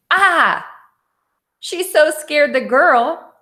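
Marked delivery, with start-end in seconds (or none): 0.1-0.7 short sharp "Ah!" scream, higher and louder; a frustrated mother screaming "Ah!"; mock scream. A narrator's reading. Mother losing patience with her lazy daughter, loud mock scream. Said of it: "the mother lost patience and screamed".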